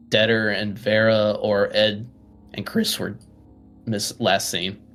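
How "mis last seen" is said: ordinary talk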